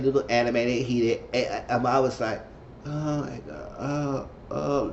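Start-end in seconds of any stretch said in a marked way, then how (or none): 2.9-4.9 much softer, breathy gasp of dismay; aghast mutter. Retold or imitated. Narrator cringing as Julian gets heated, soft aghast gasps. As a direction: mutter it as if you can't watch.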